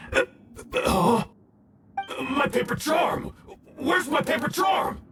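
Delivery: panicked loss. Acted. Discovers charm is missing.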